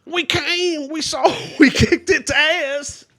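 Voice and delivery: high, slow goofy cartoon voice; Shaggy. Player reading a Ghostbusters quote as a cartoon sidekick, high voice. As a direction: push it way up high, laughing through. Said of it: "trying to get a, a Shaggy vibe".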